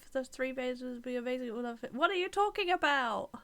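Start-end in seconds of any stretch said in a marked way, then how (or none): 1.9-3.3 much higher, louder outraged question; indignant disbelief. Real, not acted. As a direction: demand an answer, offended. Indignant at chat comment.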